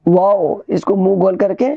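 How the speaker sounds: usual speaking voice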